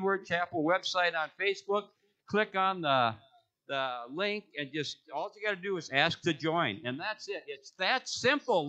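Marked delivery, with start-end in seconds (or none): none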